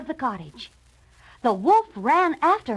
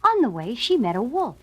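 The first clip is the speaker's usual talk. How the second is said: ordinary delivery, nothing marked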